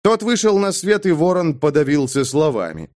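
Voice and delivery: plain reading voice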